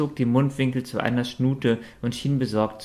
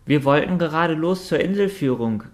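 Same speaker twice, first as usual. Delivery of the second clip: higher, louder call across the deck; calling over; 6 semitones higher, 4 dB louder than usual. A narrator's reading. Steff calls over to the dragon, raised voice. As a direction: call out to her, a little raised. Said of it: "rief ihr Steff nun zu".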